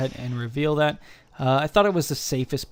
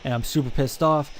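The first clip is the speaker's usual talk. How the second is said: frustrated; 7 dB louder than usual. Real, not acted. Frustrated rant over dead Rotom.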